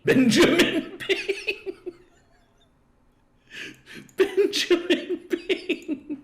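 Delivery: high, slow words squeezed through laughter; real laughter. Real, not acted. Cracking up over the chatbot argument, laughing. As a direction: crack up helplessly while saying it.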